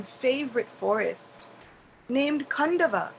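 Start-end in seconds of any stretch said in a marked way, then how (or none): none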